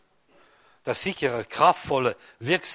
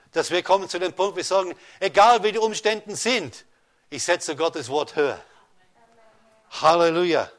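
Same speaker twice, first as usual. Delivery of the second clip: higher and louder into the quoted vow; rising sermon climax; 3 semitones higher, 3 dB louder than usual. Acted. Preacher voicing the believers' vow, higher and louder. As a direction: lift the congregation toward a triumphant vow.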